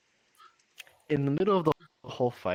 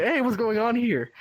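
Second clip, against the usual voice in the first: bursting out high and bright with a loud hey; spring out of the barrel with a high, loud greeting; sudden cheery pop-up; 11 semitones higher, 4 dB louder than usual. Retold or imitated. Goblin popping out of barrel.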